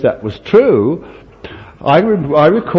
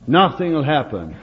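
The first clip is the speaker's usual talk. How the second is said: usual speaking voice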